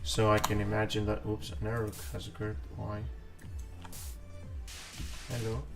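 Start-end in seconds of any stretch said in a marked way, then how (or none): none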